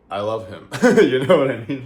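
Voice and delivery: bursting into a loud laugh, rushing the question; real laughter spilling over the words. Real, not acted. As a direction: laugh it out, quick and delighted. Gushing about a weird friend.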